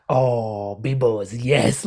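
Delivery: lightly voiced impression of a friend; imitating karaoke. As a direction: slip lightly into his drawn-out way of talking. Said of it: "that's how he talks".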